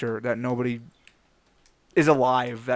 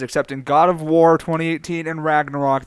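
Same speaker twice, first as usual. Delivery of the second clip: ordinary talk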